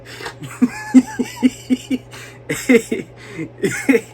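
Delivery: long, rising burst of laughter; high cackling laugh. Real, not acted. Laughing at his own comeback, high and long. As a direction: crack up at your own joke.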